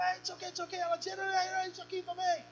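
high-pitched imitation of a couple squabbling; mock bickering. Preacher re-enacting an argument with his wife, mock bickering.

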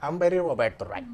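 hammed-up broken English of a fresh arrival. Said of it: "en inglés recién llegado".

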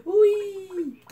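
high, loud squeal of fun; playful whee; gleeful whee. Real, not acted. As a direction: cheer as you jump. Jumping in game.

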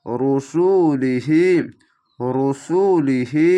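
রসুলিহি very slowly, ending হি held; slow chanted রসুলিহি; Quranic recitation. Shown as a demonstration. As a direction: draw out রসুলিহি. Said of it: "খারজের এক আলিফ টেনে পড়বেন".

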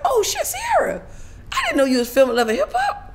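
high-pitched delighted recognition; pleasant surprise. Retold or imitated. Spotting Sierra on set, pleasantly surprised. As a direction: squeal the name, then ease down.

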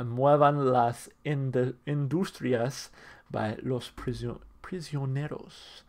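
slow, stumbling Spanish accent, restarting mid-word; carefully sounding out Spanish, apologetic; rusty Spanish accent. Shown as a demonstration. Reviewer announcing the song title before playing it, rusty Spanish accent. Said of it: "My Spanish is very rusty".

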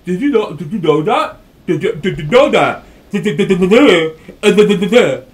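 mock the game taunting him; a child's mocking dad voice. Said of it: "Be like".

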